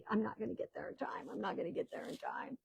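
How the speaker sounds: whispered worry to herself; hushed, fretting she'll be late; anxious thought. Retold or imitated. Grandmother fearing she'll miss the birth, whispered. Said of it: "I thought to myself".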